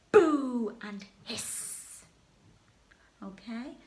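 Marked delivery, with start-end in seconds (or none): 0.1-2.0 slow, loud drawn-out boo and hiss; booing the baddie. Shown as a demonstration. Teacher models jeering King Rat, loud and slow. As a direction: jeer the villain, stretching every word. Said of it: "I would like you to say".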